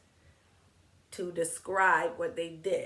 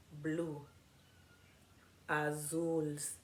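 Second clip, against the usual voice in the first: ordinary delivery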